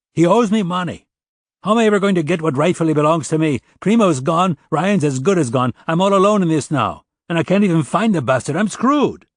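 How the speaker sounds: high, loud, fast desperate ranting; rising frantic complaint, voice climbing; angry rant. Acted. Broke musician ranting to the detective, loud and desperate. O'Dell ranting about lost money.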